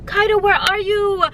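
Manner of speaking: high sing-song mock calling; calling her name. Mimicking the friends calling to lure her out. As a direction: coax like a fake-friendly seeker. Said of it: "They're like".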